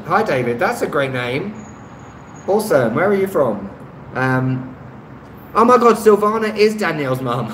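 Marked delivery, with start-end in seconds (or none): 5.5-7.5 surprised laugh. Real, not acted. Realising viewer Silvana is Danielle's mum, laughing surprise. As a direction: suddenly get it and laugh.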